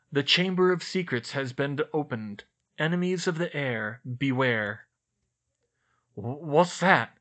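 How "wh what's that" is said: slow, stammering nervous question. A narrator's reading. Ron sees the writing on the wall, nervous stammer. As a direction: stumble over the words in fright. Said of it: "Ron said nervously".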